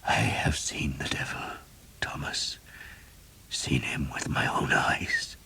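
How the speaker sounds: a weak, breathy whisper of a dying man; hushed dying priest. A narrator's reading. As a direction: whisper it, barely any strength left.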